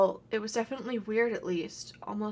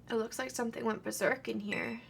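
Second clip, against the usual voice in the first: ordinary talk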